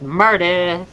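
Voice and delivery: Meredith as southern "MUR-duth"; mock southern accent. Host announcing a coming guest, mock southern "MUR-duth" for Meredith. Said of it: "we make fun of my southern accent".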